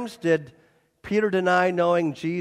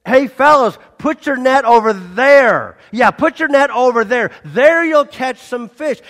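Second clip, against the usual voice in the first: loud, higher hail to distant fishermen; hearty long-distance hail; calling out across the water; 6 semitones higher, 10 dB louder than usual. Retold or imitated. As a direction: shout out to men far off in a boat. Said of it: "he yelled out to the guys".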